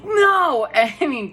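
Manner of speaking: high, loud half laugh half sob; real tears slipping into a laugh; laughing through tears. Real, not acted. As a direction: break between laughing and crying.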